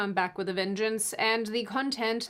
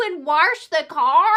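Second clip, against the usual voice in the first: loud, higher mocking Midwestern accent; 7 semitones higher, 9 dB louder than usual. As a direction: ham up a Midwestern accent on wash. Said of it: "There's no R in the word wash".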